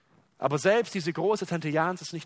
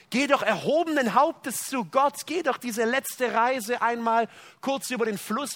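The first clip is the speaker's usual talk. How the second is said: proud praise; 7 semitones higher, 4 dB louder than usual. Retold or imitated. Imagined praise and proud arrival.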